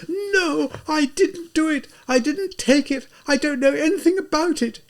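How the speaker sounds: high, wailing denial; heartrending wail. Acted. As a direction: cry out your innocence in anguish. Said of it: "she exclaimed in a heartrending wail".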